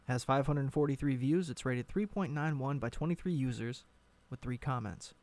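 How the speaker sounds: ordinary delivery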